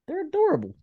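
high cooing puppy voice. Real, not acted. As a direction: coo at the puppies. Cooing over her puppies.

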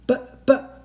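high, loud flustered stammer; sputtering. A narrator's reading. Girl interrupting her uncle, sputtering. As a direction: trip over the words in shock. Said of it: "I sputtered".

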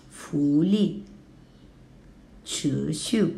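福利 rising, then sharply falling; second plus fourth tone. Shown as a demonstration. Teacher modelling 福利 for learners, rising then falling. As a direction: rise on 奇特 then drop. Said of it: "Now second and fourth tone".

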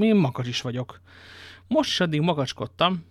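plain reading voice

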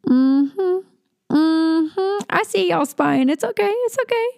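teasing sing-song. Acted. Teasing listeners who spy.

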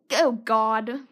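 ordinary talk

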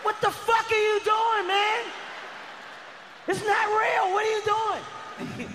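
a father panicking at his son's fight; frantic yell. Retold or imitated. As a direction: scream it across the playground. Dad yells at fighting son.

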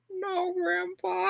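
put-on high, slow, whimpering child; whiny kid voice. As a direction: whine it like a bored little kid. Child whining to grandpa.

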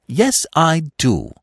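slow, firm, earnest high voice; stout insistence. A narrator's reading. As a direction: plant your feet and insist. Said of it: "said Freddy stoutly".

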